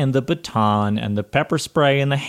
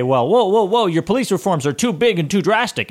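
higher, hammed-up alarmed objector; mocking conservatives; 8 semitones higher than usual. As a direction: mimic a fussy critic crying whoa. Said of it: "hearing people say".

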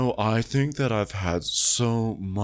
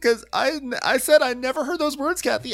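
very high, louder whiny protest; mocking Kyle; 4 dB louder than usual. Host mocking Kyle's high whiny denial to Kathy. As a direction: whine it much higher, insisting. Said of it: "And Kyle's like".

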